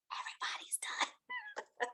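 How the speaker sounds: slow, hushed whisper; whispered aside. Retold or imitated. Storyteller realizing everyone else is done, slow whisper.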